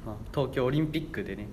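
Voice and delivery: normal talking voice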